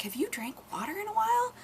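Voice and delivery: high quick cooing question; baby talk to kitten. Retold or imitated. Asking the thirsty stray kitten, high cooing baby talk. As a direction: ask it fast and sweet like to a tiny kitten. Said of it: "We were like".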